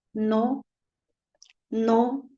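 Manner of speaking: quiet restraint, lightly voiced as God. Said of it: "la voz de Dios me dice".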